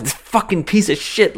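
high-pitched, reenacted burst of frustration; short-tempered outburst. Imitating oneself putting something together, in a short-tempered outburst. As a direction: act out losing patience with stubborn flat-pack furniture. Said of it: "especially me, very short tempers".

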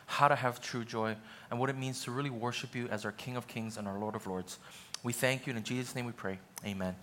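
low, hushed prayer voice. Acted. Pastor praying before the sermon, quiet and reverent. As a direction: pray it quietly to God.

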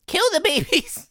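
very high little creature voice; Twiggly's squeaky voice. Acted. Twiggly urging the party on, high squeaky voice. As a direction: chirp it out high and tiny.

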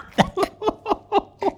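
high burst of laughing; helpless laughter. Real, not acted. Storyteller losing it at the gross bit, helpless laughter. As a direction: crack up mid-story.

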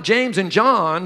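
ordinary delivery

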